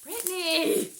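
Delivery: loud, high cry of a friend's name; delighted shout. Real, not acted. Thrilled at a gift from her friend Brittany, loud happy shout.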